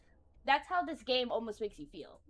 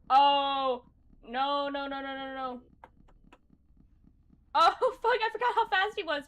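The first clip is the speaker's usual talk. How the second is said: loud, high panicked yelps; panicked excitement; 6 semitones higher, 13 dB louder than usual. Real, not acted. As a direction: freak out as he rushes you. Panicked fight, laughing at speed.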